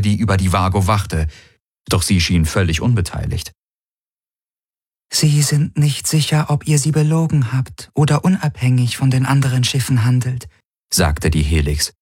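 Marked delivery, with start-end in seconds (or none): 5.1-10.5 higher, otherworldly alien voice; alien entity voice. A narrator's reading. The Helix explains the ships' doubt in a strange voice. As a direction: sound distant and strange, not human.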